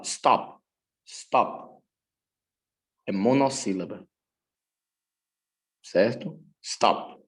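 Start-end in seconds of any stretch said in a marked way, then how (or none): 0.0-1.8 "Stop" in one short, unbroken syllable; one syllable, no pauses. Shown as a demonstration. Teacher showing students it is monosyllabic, "Stop" without a break. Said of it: "Vejam como é que eu falo". 6.6-7.2 "Stop" as a single short beat; one syllable, no pauses. Shown as a demonstration. Teacher proving the word is monosyllabic, "Stop" unbroken. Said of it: "eu não falo ela com pausas".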